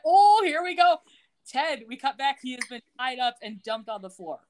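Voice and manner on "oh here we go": high and loud, knowing groan of here-it-comes; playful sing-song of anticipation; mock dread. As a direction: pitch up and lean in like trouble is coming. Host's own reaction, retold.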